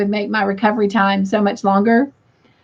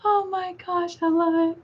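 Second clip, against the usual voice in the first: laughing; 7 semitones higher, 7 dB quieter than usual. Real, not acted. After a long laughing fit.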